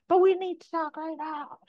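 mock insistent plea to the partner; insistent whine. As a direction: push back hard, half-laughing. Insisting against partner's refusal.

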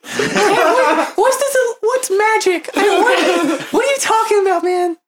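hammed-up innocent character; high mock innocence. Player feigning innocence in character.

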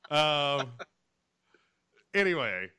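usual speaking voice

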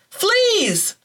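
sudden high alarmed cry; cried out. A narrator's reading. Woman discovering fleas, crying out in alarm. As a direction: yelp it in alarm. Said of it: "I cried".

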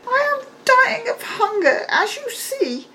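high, weepy crone voice; an old hag whimpering about hunger; high sobbing witch voice; starving old beggar woman. A narrator's reading. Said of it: "she said again".

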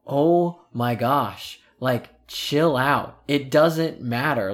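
slow, drawn-out retold exasperation; exasperated dad. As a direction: stretch each phrase to make the child calm down. Imitates dad calming him down.

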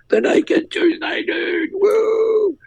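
high, drawn-out excited shout of recognition; excited yell. Retold or imitated. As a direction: yell it like a thrilled fan spotting a celebrity. Stranger runs up at the footy.

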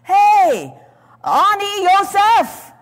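high, loud, slow cry from the pit; boy screaming his own name; indignant scream. Retold or imitated. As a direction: shout up out of the pit in shock. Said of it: "screamed in shock and indignance".

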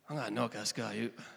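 put-on a light Glaswegian accent, fast and low; David boasting in a Glaswegian accent. Pastor voicing David in a Glaswegian accent, lightly done. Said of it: "in pure Glaswegian accent".

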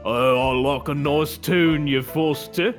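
drawn-out mock regional English accent; broad dialect. Customer rephrasing for the baffled owner, broad dialect. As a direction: put on a slow, broad dialect.

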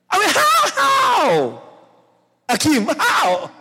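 high-pitched, drawn-out wail of disbelief; incredulous outcry. Pretend. Preacher questioning the illustration, incredulous outcry.

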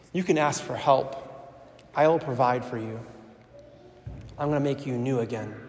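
quiet, tender promise of mercy; tender voice of Jesus. Acted. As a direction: speak softly as a loving God would. Jesus speaking tenderly to sinner.

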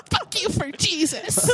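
high squealing laugh through words. Real, not acted. Laughing mock curse.

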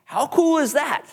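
jumps way up on cool, delighted; excited. Real, not acted. Pastor delights to the congregation over a friend's new life, excited. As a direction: let the wonder burst out on cool.

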